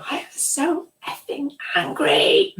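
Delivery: high-pitched mock scream of fury; retold angry scream. Repressed client finally shows the therapist her anger, screamed high. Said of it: "she screamed".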